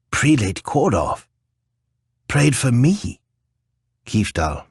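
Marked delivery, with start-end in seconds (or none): 0.1-3.2 rising in disbelief; high, astonished question; surprised. A narrator's reading. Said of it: "blinked several times in surprise".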